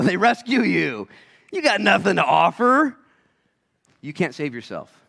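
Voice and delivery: usual speaking voice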